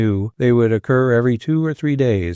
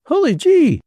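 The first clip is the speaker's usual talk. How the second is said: high startled cry, much higher; aghast cry; 4 dB louder than usual. A narrator's reading. Tenburum aghast at the roll of money, startled cry. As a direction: gasp it out in shock. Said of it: "cried Tenburum aghast".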